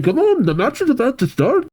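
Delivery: high, excited hurry-up; rally them to the match; urging. Acted. Casey hurries everyone along.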